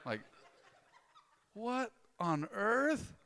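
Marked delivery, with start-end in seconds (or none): 1.5-3.1 drawn-out what on earth; slow incredulous question; slow disbelief. Real, not acted. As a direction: stretch it out in disbelief.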